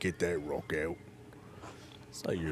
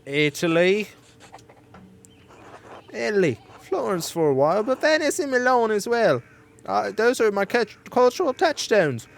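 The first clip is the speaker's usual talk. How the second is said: higher, hammed-up foreign accent for Andreas; awful put-on accent; 8 semitones higher, 3 dB louder than usual. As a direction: play Andreas with a deliberately bad accent. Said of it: "my awful accent".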